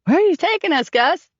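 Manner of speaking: high-pitched shout toward the cockpit. A narrator's reading. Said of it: "shouting toward the cockpit".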